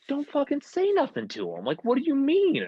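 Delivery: very high-pitched mock panic; stressing out. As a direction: squeal it like a stressed-out friend, hammed-up. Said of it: "this kid starts stressing out".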